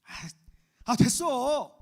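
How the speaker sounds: loud, high "아, 됐어"; emphatic mock refusal. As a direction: wave the helper off with a loud exclamation. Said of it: "내가 해줄게". (더 세게 사양하는 흉내)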